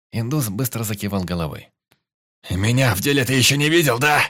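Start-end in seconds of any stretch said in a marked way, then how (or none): 2.5-4.2 loud, high taunting yell; shouted boast. A narrator's reading. Пётр орёт на индуса, хвастаясь. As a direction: yell it right in his face. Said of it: "орал Пётр".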